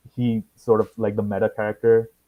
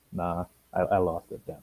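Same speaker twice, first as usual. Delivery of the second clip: ordinary delivery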